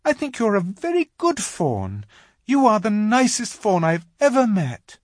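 high, insistent Lucy; consoling little girl. A narrator's reading. Lucy praising the crying faun to comfort him, earnest. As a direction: insist kindly in a girl's high voice.

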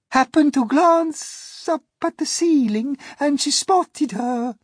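a high-pitched spider lady recounting a sad tale; Miss Spider's lament. Acted. Miss Spider telling friends of grandmother, high plaintive voice.

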